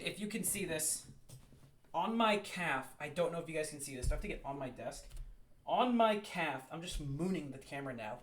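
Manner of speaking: distant, off-mic voice; talking from across the room; far from mic. Real, not acted. Standing on chair, far from mic.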